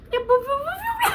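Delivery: very high, laughing squeal; excited squeal. Real, not acted. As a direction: squeal it out with giddy joy. Squealing over the perfume.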